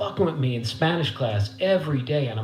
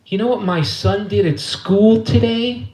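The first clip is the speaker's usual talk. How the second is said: high, slow imitation of Mom; put-on scandalized mother; mother telling news; 8 semitones higher, 4 dB louder than usual. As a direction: draw out the question in a raised voice. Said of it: "and I hear her say".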